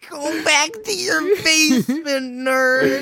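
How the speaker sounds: high squeaky laughing yell; laugh-crying. Acted. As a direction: crack up while yelling it. Repeating the line, crying-laughing.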